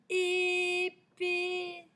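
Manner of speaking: ÉPÉE, both é held high and loud; elongated vowels. Shown as a demonstration. Teacher shows the repeated é, "épée" loudly drawn out. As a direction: stretch "épée" syllable by syllable. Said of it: "C'est encore le son et".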